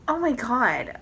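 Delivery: normal talking voice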